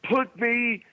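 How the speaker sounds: high, slow pleading wrestler promo; over-the-top wrestling promo plea; Ric Flair rant. Retold or imitated. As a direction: beg to be put in the cage, drawn out and high. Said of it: "He says".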